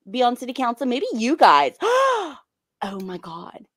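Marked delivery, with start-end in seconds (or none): 1.8-2.3 sharp surprised gasp. Real, not acted. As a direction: gasp in sudden realization. Sudden idea.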